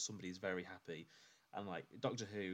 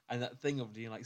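usual speaking voice

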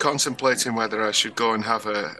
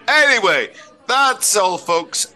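cartoon sign-off; 9 semitones higher, 4 dB louder, slower than usual. Show sign-off.

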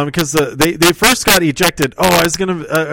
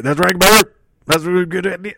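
higher, louder brash brother-talk; hammed-up wrestler agreement; 5 semitones higher, 3 dB louder, faster than usual. Partner loudly agrees with the plan, hammed-up. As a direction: bark it like a cocky wrestler. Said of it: "And Karl Osler's like".

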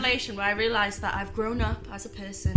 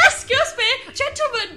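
put-on high, loud old lady voice; man doing granny voice; 13 semitones higher, 5 dB louder than usual. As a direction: squeak politely like a granny. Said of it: "In an old lady voice".

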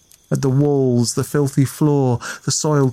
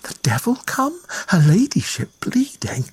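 frightened whispered questions; whispered thought; 8 semitones higher than usual. A narrator's reading. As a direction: whisper the fears in a panic. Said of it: "she thought".

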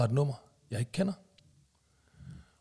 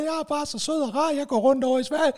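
innocent sweet voice; 10 semitones higher, 4 dB louder than usual. Pretend. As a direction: play the harmless little angel. Acting innocent on the phone. (høj, sukkersød uskyldig stemme)

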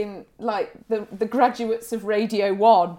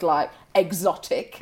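ordinary delivery

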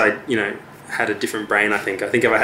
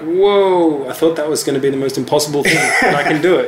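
excited laughing disbelief; thrilled, laughing at himself; amazed and laughing; 5 semitones higher, 3 dB louder than usual. Retold or imitated. Retold thrill of first song.

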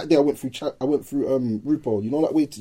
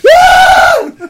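very high, loud wild scream; 11 dB louder than usual. Retold or imitated. Friend scaring off the youths, wild high scream. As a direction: let out a raw battle scream. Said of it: "Start screaming".

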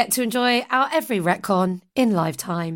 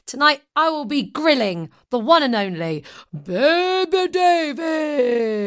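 announcer intro; 5 semitones higher than usual. Acted. Hyped host introduction.

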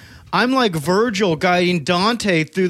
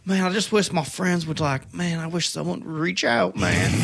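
softer, lower self-pitying murmur; mock lonely plea; 5 semitones lower, 6 dB quieter than usual. Mocking Dalton, a softer lower mock lonely plea. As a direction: sigh it like a lonely sad sack. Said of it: "He's like".

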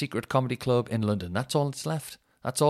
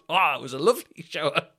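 mock annoyed passenger; 7 semitones higher, 7 dB louder than usual. Mocking a passenger he delayed.

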